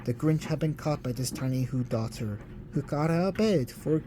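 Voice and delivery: usual speaking voice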